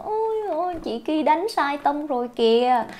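high-pitched mock "ôi, ôi" of dismay; imitating a complaining viewer. Viewer calling out teacher's wrong key, mock dismay. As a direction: act out someone catching a mistake, starting high. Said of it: "phải kiểu của bạn nào lại vào bảo".

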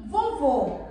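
vovô said slowly with a closed ô; som fechado. Shown as a demonstration. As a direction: draw out vovô, closing the final vowel. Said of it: "Observe a diferença". (vovô devagar, com o ô final fechado)

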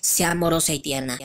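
ordinary talk, nothing marked

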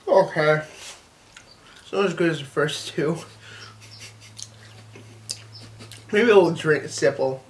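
words through chewed food; talking while chewing. Real, not acted. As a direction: mouth partly full. Seen 1: Bites pickle at 2.1-2.5, then talks while chewing afterwards.

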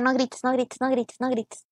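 soft, low repeated self-warning; hushed self-restraint. Real, not acted. Frustrated gamer telling herself not to scream, hushed. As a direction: keep your voice down, talking to yourself. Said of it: "No puedo gritar".

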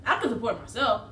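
chewing between words; talking while eating. Real, not acted. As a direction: mouth partly full. Seen 5: Holds folded flatbread throughout, bites it mid-speech around 3.3–4.9 and 8.0.

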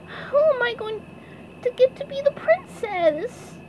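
high, small pleading voice; begging. A narrator's reading. SpongeBob begging Patrick for help, high plea. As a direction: beg in a high cartoon whine. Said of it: "SpongeBob begged".

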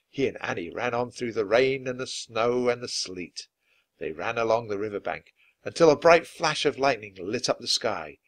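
plain reading voice

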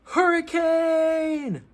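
high, very slow, stretched-out cry of Hurricane; dramatic announcement. Acted. Reader announcing the next chapter to kids, long dramatic cry. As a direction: draw the word out like a storm rolling in.

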